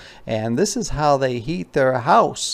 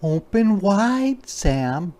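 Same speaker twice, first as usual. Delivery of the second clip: mama bear coaxing, much higher and slow; 9 semitones higher, slower than usual. Acted. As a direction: coax the cub to open up.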